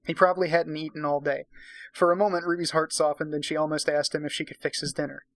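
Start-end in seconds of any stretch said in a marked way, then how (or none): none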